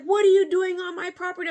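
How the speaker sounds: high, loud indignant demand; loud angry neighbor. Retold or imitated. Neighbor catching her on his property, loud and indignant. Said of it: "They're like".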